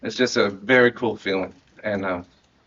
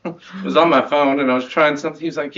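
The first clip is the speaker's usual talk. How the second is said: ordinary talk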